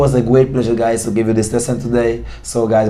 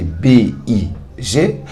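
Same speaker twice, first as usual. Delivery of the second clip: B I G spelled out very slowly, letter by letter; spelling letter by letter; 2 semitones lower, much slower than usual. Shown as a demonstration. Teacher spelling big for students. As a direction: spell it out for the class. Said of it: "par exemple, big".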